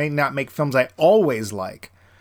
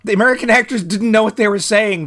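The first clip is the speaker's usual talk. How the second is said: mock realization; 7 semitones higher, 4 dB louder than usual. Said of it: "it's like".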